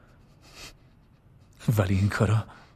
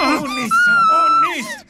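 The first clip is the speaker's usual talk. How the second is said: desperate screaming shout; 6 dB louder than usual. Acted. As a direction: scream it out in panic. Exorcism scene, screaming.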